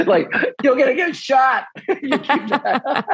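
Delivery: high laughing voice, words squeezed out; laughing while talking. Real, not acted. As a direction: crack up through the punchline, the last words slow. Laughing at his own story.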